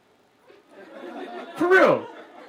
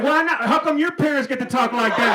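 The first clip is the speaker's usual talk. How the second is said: mock indignant protest; 6 semitones higher, 4 dB louder than usual. Acted quarrel with sister-in-law.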